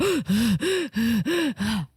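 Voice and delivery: imitated heavy panting; mock huffing and puffing from running; out-of-breath panting. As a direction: gasp for air like a winded runner. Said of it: "Literally running. She's like".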